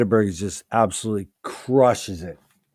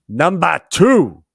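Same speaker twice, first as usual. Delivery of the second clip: dramatic reveal; 4 semitones higher, 10 dB louder, slower than usual. Real, not acted. Teasing countdown reveal.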